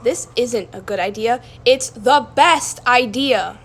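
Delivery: loud, slowing on best, confident; bold reply. Acted. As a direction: insist it's the best idea. Amelia answers boldly.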